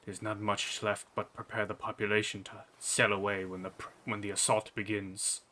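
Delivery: subdued, let-down delivery; disappointed king. Acted. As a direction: let the voice sink in quiet disappointment. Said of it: "almost a disappointed drop in tone".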